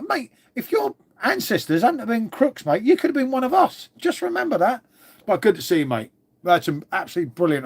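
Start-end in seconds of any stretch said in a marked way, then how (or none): 0.1-4.9 high, cheeky ribbing of an Aussie, pitched up; mock teasing. Streamer teasing an Australian viewer about convict ancestors, cheeky and high. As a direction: rib him like an old mate down the pub.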